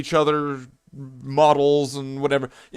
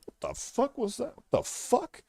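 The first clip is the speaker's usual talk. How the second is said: baffled whisper; 9 semitones higher, 10 dB quieter than usual. Retold or imitated. Everyone reacting in chat.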